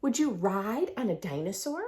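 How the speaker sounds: ordinary delivery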